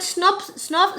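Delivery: scolding mother. Retold or imitated. Tired mother telling her son to stop crying, lightly voiced nag. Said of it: "she used to keep on telling him".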